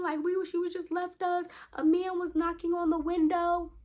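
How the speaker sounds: put-on very high upset child voice; imitated crying little girl. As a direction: mimic a small child tattling through tears. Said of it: "so my niece is crying".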